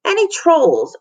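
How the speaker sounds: curious schoolboy on the playground; child's voice. Acted. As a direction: pipe up like a small boy. Kids asking questions.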